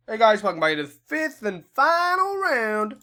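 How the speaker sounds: bright presenter opener; upbeat vlog intro. Acted. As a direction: welcome viewers like a show host. Video intro greeting.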